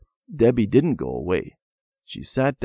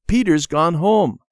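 put-on high, loud child's call; 8 semitones higher, 7 dB louder than usual. A narrator's reading. Randy calling Debbie out to play, calling out. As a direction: call a playmate from across the house. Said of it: "he called".

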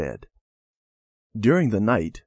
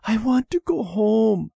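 put-on much higher, frightened child's plea; trembling, scared little girl; 11 semitones higher than usual. Frightened sister begging her brother at night, high trembling plea. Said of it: "she whispered in a trembling voice".

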